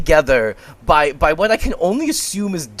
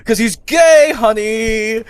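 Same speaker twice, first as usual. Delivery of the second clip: campy sass; 5 semitones higher, 5 dB louder, slower than usual. Pretend. Repeating the campy bit.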